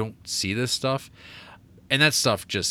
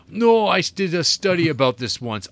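higher, louder mock indignation; mock protest; 6 semitones higher, 5 dB louder than usual. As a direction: protest like a hammy film expert. Mocking a film character's protest.

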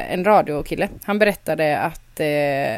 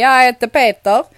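put-on louder Skåne accent; fake Skåne accent; 4 semitones higher, 8 dB louder than usual. New boy introducing himself to class, in a faked Skåne accent. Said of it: "På skånska".

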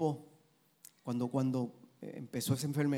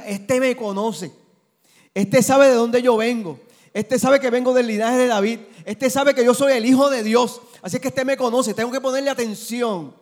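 voicing Jesus, loud; 9 semitones higher, 13 dB louder than usual. Pastor voicing Jesus hearing Bartimeus, booming proclamation. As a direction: proclaim it with conviction. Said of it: "Jesús entendía".

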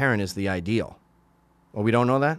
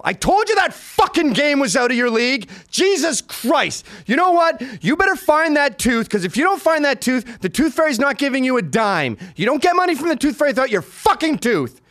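loud, high-pitched furious dad rant; super mad; 12 semitones higher, 5 dB louder than usual. Acted. Father to his bleeding daughter over the tooth, super-mad yelling. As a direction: tear into them at full shouting pitch. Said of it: "don't swear at them unless I'm super mad".

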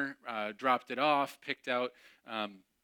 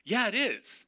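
stubborn insistence; 10 semitones higher, 5 dB louder than usual. Retold or imitated.

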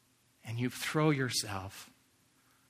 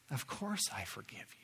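softer, unvoiced whisper; tender whisper; 9 dB quieter than usual. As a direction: whisper it like God comforting. God's voice, tender forgiveness.

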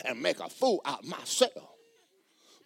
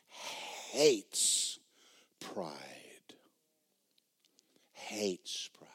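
whisper; 7 semitones lower, 9 dB quieter, much slower than usual. Real, not acted. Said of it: "whispered emphasis".

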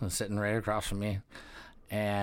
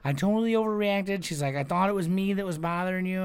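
higher, sheepish imitation; apologetic girl; 9 semitones higher than usual. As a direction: lightly voice the girl owning up.

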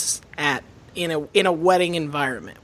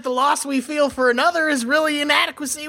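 fast, higher mock-tough therapy talk; shit-kicking grief counselor; 6 semitones higher, faster than usual. Grief counselor lecturing mourners, shit-kicking Southern voice. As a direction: rattle off counseling lines like a Southern hard case. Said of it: "doesn't work if you're a grief counselor".

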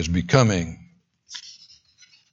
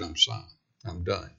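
much softer, lower final words; hushed God; 6 semitones lower, 12 dB quieter than usual. Acted. God turning away from Israel, softly and low. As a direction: let God's words fade almost to nothing.

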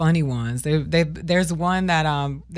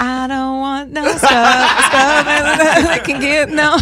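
laughing mock; 7 semitones higher, 6 dB louder, slower than usual. Mocking the boast, laughing.

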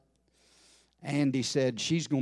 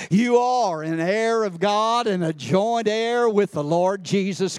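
louder revival preaching, driving each phrase; preaching at full force; 4 semitones higher, 4 dB louder than usual. Acted. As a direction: proclaim it to the whole church.

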